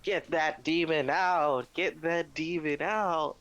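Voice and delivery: high, drawn-out mock preacher's cry; screaming pastor. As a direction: imitate a pastor casting out a demon, stretching each word. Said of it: "this pastor's, like, screaming".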